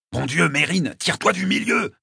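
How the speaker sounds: irritated, rising in pitch; growled order. A narrator's reading. Mike ordering Mérine out of the fight, growled. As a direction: growl at a friend in the way. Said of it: "grogna Mike".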